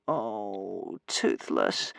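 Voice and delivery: low, soft groan of dismay; moaning. A narrator's reading. Hiccup hiding his eyes after the disaster, low soft moan. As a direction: moan it quietly, sinking low. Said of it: "moaned Hiccup".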